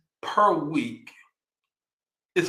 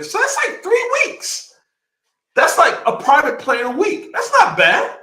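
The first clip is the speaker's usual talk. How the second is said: shrill high excited squeal, much higher and louder; 13 semitones higher, 7 dB louder than usual. Real, not acted. Excited realization about private jets.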